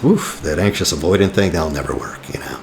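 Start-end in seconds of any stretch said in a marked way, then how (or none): 1.5-2.6 a softer, whispered "that'll never work"; hushed knowing aside. Real, not acted. Knowing aside about a bad match.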